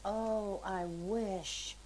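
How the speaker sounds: softly imitated tearful wish; retold weeping. Man told he will marry, retold weeping. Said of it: "like, weeping and sobbing and crying".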